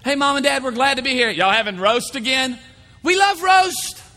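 high, loud tactless blurting; unfiltered spouse at the in-laws' table, loud and tactless; no-filter blurting. Acted. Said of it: "the person has no filters".

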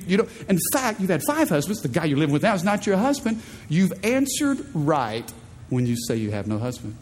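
usual speaking voice

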